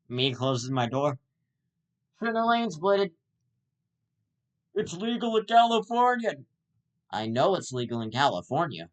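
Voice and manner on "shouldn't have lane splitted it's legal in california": higher put-on whiny biker; mock biker voice. The fallen biker arguing it's legal, higher whiny put-on voice. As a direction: play the injured biker protesting.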